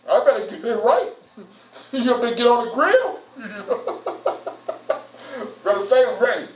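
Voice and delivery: ordinary talk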